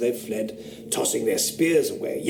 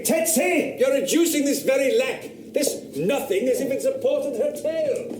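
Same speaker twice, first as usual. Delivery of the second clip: higher pitched, exasperated scolding; sharp rebuke; 12 semitones higher than usual. Acted. Official scolding the young woman for her tale, exasperated. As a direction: call her name and scold her.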